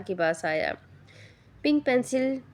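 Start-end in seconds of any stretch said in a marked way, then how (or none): 1.6-2.4 teasing sing-song nickname, higher; mischievous. A narrator's reading. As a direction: tease the boy with a silly name. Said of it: "शरारती लहजे में बोला".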